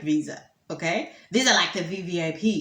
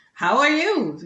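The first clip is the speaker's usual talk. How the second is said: higher, louder polite inquiry; reporter greeting; 7 semitones higher, 5 dB louder, slower than usual. Acted. Reporter greets the minister, higher and louder. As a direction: greet her as an upbeat caller. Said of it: "greets the reporter".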